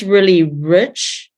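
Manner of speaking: ordinary delivery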